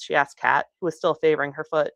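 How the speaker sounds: ordinary delivery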